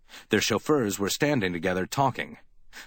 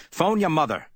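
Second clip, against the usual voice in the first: higher, firm order; commanding; 8 semitones higher, 4 dB louder than usual. A narrator's reading. Spade ordering Effie to call home, commanding. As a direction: give it as a curt command. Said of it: "he commanded her".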